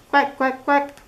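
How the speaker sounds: high, bright duck quacks; Little Duck quacking. Acted. Duck greets chickens at door.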